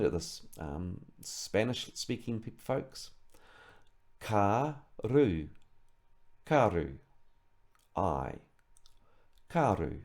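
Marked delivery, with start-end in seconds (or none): 4.2-10.0 karu with a lightly rolled r, ka-ru; soft rolled r. Shown as a demonstration. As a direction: tap the r in karu softly. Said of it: "R has a soft roll".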